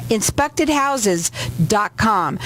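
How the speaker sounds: each word drawn out slowly; slow and clear. Real, not acted. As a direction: spell the web address out slowly. Host spells out website slowly.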